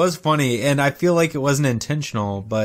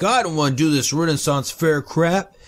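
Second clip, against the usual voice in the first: imitated reluctant grumble; put-on doubtful complaint; mock skeptical; 3 semitones higher than usual. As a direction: lightly voice a sceptic who wants no part of it. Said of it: "really skeptical and like".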